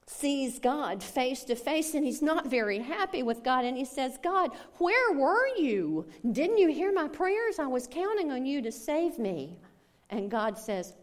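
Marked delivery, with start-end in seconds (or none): 4.2-9.6 much higher, louder reproach; indignant complaint. Acted. Dead man in heaven confronting God, high and indignant. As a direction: demand answers from God.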